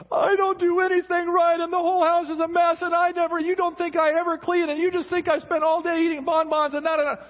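high, loud, rapid-fire wounded wife; shrill mock tirade of a hurt wife; wife's hurt outburst. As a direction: blow up like an offended wife, hammed-up. Wife voiced overreacting in hurt outburst.

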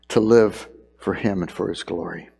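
ordinary delivery

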